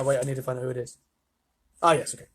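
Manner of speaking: usual speaking voice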